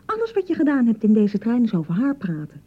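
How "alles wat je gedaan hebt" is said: much higher, shrill accusing cry; jealous outburst. Acted. Jealous bride accuses her husband over Louise, much higher and furious. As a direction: fling the question at him in jealous fury.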